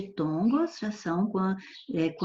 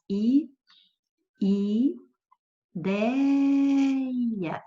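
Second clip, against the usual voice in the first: i, i, deia, with deia drawn out high; separated syllables; 5 semitones higher, much slower than usual. Shown as a demonstration. Teacher separating ideia into syllables, ending high and long. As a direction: split ideia and stretch the stressed dei. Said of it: "vou até separar a sílaba".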